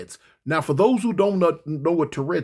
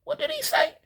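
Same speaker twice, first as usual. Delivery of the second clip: rising higher in disbelief; baffled; 3 dB quieter than usual. Retold or imitated. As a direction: squeak it out, baffled. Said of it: "in my mind, I'm like".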